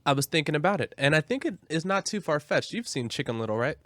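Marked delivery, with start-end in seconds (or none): none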